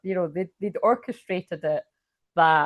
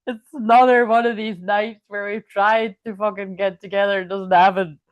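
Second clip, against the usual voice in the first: louder, half-laughing mock wail; drunk crying plea; 2 semitones higher, 7 dB louder than usual. Alone at the fence, crying for him, loud mock wail. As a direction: replay the drunk sobbing at the fence, hammed-up and loud. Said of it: "so I started crying".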